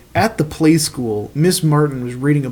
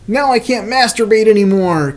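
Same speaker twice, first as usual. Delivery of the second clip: mock whine; 6 semitones higher, 3 dB louder than usual. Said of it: "Caillou whined".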